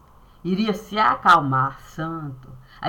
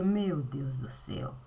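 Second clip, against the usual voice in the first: breathless whispered "Meu Deus do céu"; shocked whisper; 5 semitones lower, 11 dB quieter than usual. Retold or imitated. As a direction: whisper it in disbelief. (sussurro chocado)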